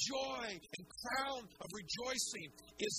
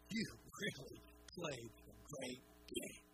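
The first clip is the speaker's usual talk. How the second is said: hushed, gentle coach's praise, softer; tender praise; 6 semitones lower, 7 dB quieter than usual. Retold or imitated. Coach praising a child at the sideline, hushed and tender. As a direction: lean in close and praise the kid quietly.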